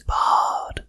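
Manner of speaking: breathy whispered word. Acted. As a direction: whisper it right in their ear. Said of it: "a voice in your ear whispers".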